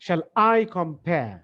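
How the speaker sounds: "Shall I" kept light, "compare" drawn out and stressed; very slow, weight on "compare" not "Shall". Shown as a demonstration. Teacher correcting the stress pattern for the class, very slow. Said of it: "shall emphasis কম, আইতে compare".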